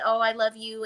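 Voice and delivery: sarcastic, syrupy mock "I love you"; lightly voiced insincere love; mock fake affection. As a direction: parody a fake friend's gush. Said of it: "do the little fake".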